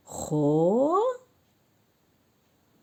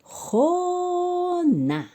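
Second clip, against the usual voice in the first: خونا held long and pitched much higher; drawn-out blended word; 11 semitones higher, slower than usual. Shown as a demonstration. Teacher blending syllables for the children, slow and high. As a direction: stretch خونا slowly, lifting it high.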